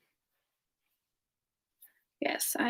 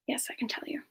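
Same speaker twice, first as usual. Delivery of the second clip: whisper; 11 dB quieter, faster than usual. Real, not acted. Coy repeated promise to chat.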